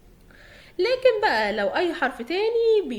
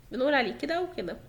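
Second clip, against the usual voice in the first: ordinary talk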